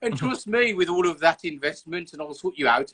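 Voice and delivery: mock pitching an event in broad Birmingham; a Brummie boardroom pitch, hammed up; Brummie accent pitch. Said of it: "and not speak like".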